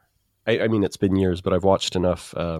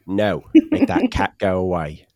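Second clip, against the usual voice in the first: Michael Caine impression; 3 dB louder than usual. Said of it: "the fish is Steve Coogan doing Michael Caine".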